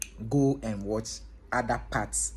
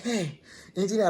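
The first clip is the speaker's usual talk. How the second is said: out of breath; 8 semitones higher than usual. Retold or imitated. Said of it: "like he was trying to catch his breath".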